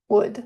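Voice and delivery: "wood" said alone, sounding just like would. Shown as a demonstration. Teacher compares it to madera, "wood" as the model sound. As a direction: model "wood" with a plain d ending, no L. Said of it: "Tal como madera".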